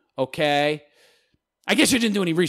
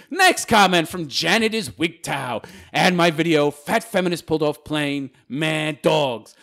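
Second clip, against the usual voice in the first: ordinary talk, nothing marked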